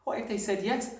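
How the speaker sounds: put-on high, hopeful child's voice; eager cry. A narrator's reading. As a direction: plead excitedly with your teacher. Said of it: "Matilda cried eagerly".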